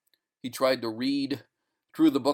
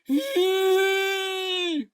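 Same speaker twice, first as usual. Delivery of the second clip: very high long burst of sound; shrieking squeal; 5 dB louder than usual. Acted. Sleeping man crying out, high shriek. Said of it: "The sound burst from his mouth".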